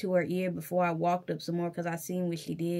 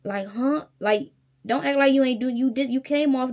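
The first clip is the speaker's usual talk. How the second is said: raised, incredulous scoff; sassy disbelief aimed at the teller; mocking disbelief; 5 semitones higher, 6 dB louder than usual. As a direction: call out her act louder. Mocking the teller's blank look.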